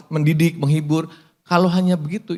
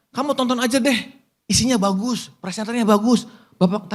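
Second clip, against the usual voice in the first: lightly acted, higher-pitched persuader; a hint of a higher sales-pitch voice; mock coaxing plea; 6 semitones higher than usual. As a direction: imitate someone urging a viewer to watch. Voices someone urging a viewer.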